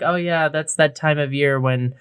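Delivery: ordinary delivery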